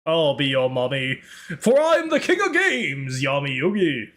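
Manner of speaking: pompous parody duelist, low then soaring; Yami Yugi. Shown as a demonstration. Anime champion answering chat, grandiose and swooping. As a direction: swell from a low croon into a grand boast. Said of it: "I can do Yama Yugi".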